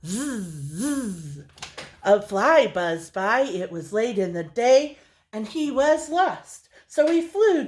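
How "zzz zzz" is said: soft buzzing fly sound; low fly buzz; buzzing zzz. Shown as a demonstration. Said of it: "Can you do that?".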